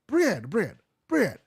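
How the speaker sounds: loud and high, rising on the last word; insistent customer. Retold or imitated. Customer at Walgreens shouting bread at the new cashier. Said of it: "You repeat the same thing they said".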